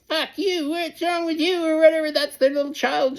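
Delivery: very high, squeaky tantrum voice; mock mad. Angry parent acting like a little child, mock high-pitched tantrum. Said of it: "maybe it'll make 'em mad".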